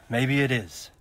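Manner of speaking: weary, reluctant agreement; resigned sigh. Acted. Lord accepting the attack plan with a resigned sigh.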